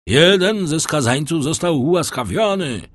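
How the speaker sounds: high, loud public announcement; calling out. A narrator's reading. Brotherhood superior announcing a pardon, calling out loudly. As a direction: proclaim it over a whole crowd. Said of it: "zawołał".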